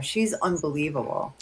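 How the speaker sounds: ordinary talk, nothing marked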